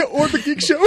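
loud high laughing burst; laughing shout. Real, not acted.

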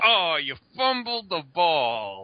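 high, slow mocking tease; teasing taunt. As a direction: rub it in slowly. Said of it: "But this time I was like".

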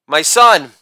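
usual speaking voice

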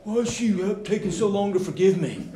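high, pouty whine; a hint of a sulking man; pouting husband. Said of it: "he's kind of pouting like".

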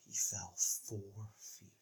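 very hushed, horrified gravity; somber announcer. Retold or imitated. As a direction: imitate an announcer fading to almost nothing. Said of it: "it was all somber".

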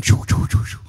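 whispery "choo" sound effect; rapid voiceless whooshes; imitated flying-object noise. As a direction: mimic a toy whizzing through the air. Imitates thrown toy flying through air.

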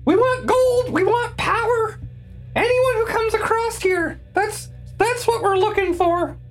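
high, pained goblin whine. Acted. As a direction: yelp and plead like a goblin. Goblin pleading under pressure.

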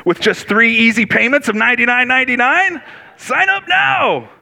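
fast, high salesman hype; infomercial pitch. Pretend. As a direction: sell it like a late-night ad.

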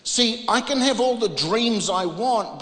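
usual speaking voice

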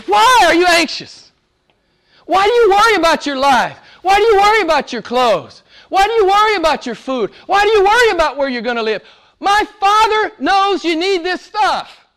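high-pitched, exasperated exhortation; impassioned challenge. Acted. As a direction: hammer each question as a preacher voicing Jesus. Jesus' challenge acted out loudly.

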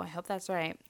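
usual speaking voice

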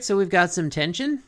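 ordinary delivery

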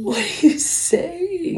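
very high, drawn-out question; playfully baffled. Real, not acted. Mother answering child's nonsense, high and baffled. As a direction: stretch it up high in mock bafflement.

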